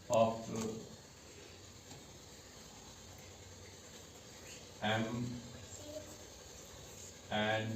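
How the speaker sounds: each word spaced out for note-taking; slow dictation pace. Shown as a demonstration. Teacher dictating the rule to the class, slow and spaced. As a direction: dictate slowly so students can write. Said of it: "आप भी note down करेंगे".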